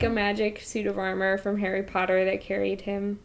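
normal talking voice

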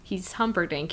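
ordinary talk, nothing marked

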